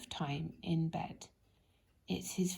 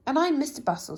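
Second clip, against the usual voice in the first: louder, brisk self-introduction; 7 semitones higher, 8 dB louder, faster than usual. Acted. Mister Busy introducing himself to Lazy, brisk and loud. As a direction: announce yourself briskly. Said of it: "said the other".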